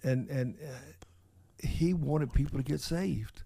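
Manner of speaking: hushed, earnest confession; quiet and sincere. Real, not acted. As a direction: drop the voice for what matters most. Solemn point about salvation.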